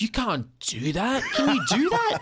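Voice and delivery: high, rising mock disbelief with a laugh; laughing, hammed-up astonishment; amazed disbelief. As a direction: build to an incredulous high question. Retells his amazed reaction to Aussie singing.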